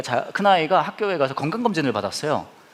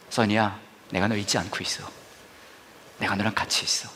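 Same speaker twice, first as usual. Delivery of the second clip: lower, softer, gentle reassurance; tender voice of God; 7 semitones lower, 6 dB quieter than usual. Acted. God quietly reassuring the pastor, soft and tender. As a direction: speak it like a quiet comforting promise.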